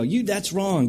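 a lightly voiced scolding critic; mock harsh corrector. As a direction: play the know-it-all who pounces. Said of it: "hear some errant theology and go".

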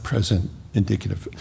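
ordinary delivery

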